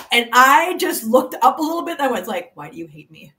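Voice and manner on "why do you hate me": small, low, soft lament; mock-pitiful plea. Woman looking up at heaven before gorgeous firemen, mock-pitiful murmur. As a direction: sink into a tiny, hopeless murmur.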